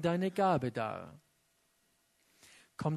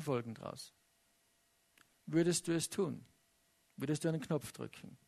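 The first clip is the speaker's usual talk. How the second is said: softer, confiding question fading to near hush; intimate appeal; 5 dB quieter, faster than usual. Acted. Preacher asking each listener personally, hushed and probing. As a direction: lean in and ask them personally.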